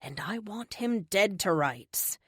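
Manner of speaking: ordinary delivery, nothing marked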